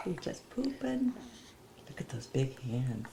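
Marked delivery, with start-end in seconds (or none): 0.1-1.1 high cooing. Real, not acted. Talking to baby.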